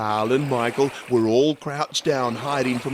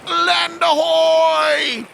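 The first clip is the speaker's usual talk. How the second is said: very high, louder, slow drawn-out shout; 7 dB louder, much slower than usual. A narrator's reading. Carl at the front spotting land, long lookout shout. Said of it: "shouted Carl".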